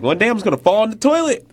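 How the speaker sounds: high, loud imitation of a nagging mom; exasperated mom. Son quoting mom about the toilet seat, exasperated. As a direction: mimic mom complaining, pitch jumping way up. Mom's complaint acted out.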